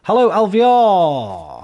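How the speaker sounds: long, high, loud hello; sing-song welcome call; drawn-out greeting. Real, not acted. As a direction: stretch out the hello to a viewer. Streamer greeting chat member.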